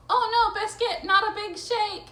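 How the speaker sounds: high, loud playful protest; mock alarm. Owner pleading with wet puppy not to shake, mock alarm. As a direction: cry out in playful dismay.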